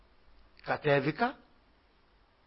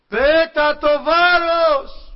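very high, slow, much louder commanding shout; heavenly shout; 13 semitones higher, 12 dB louder, much slower than usual. Retold or imitated. Voice from heaven shouts at the dreamer, high and loud. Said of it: "μία φωνή από τον ουρανό να μου φωνάζει".

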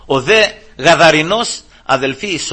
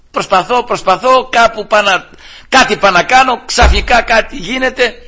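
louder, higher, with rising fervour; fervent preaching; 8 semitones higher, 5 dB louder than usual. Acted. Preacher to congregation, loud fervent preaching. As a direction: drive the point home to the congregation.

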